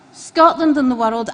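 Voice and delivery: usual speaking voice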